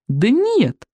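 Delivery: cheerful. A narrator's reading. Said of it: "весело заверил он вождей".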